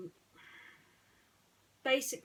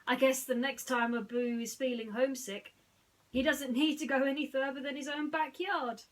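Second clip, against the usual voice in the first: loud, higher, merry quip; Aladdin, amused; 6 semitones higher, 8 dB louder than usual. Acted. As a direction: joke about it with a grin. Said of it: "Aladdin burst out laughing".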